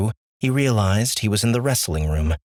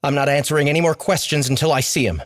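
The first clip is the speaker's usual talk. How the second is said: higher, fast defiant insistence; fast defiant refusal; 7 semitones higher, faster than usual. Acted. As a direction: push back quickly. Austin defiant.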